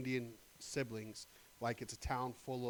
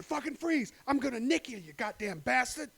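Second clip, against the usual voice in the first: much higher, loud hammed-up threat; cop shouting freeze; 11 semitones higher, 9 dB louder than usual. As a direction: scream it at gunpoint like a movie cop. Acting cop with her gun.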